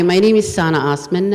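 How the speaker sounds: ordinary talk, nothing marked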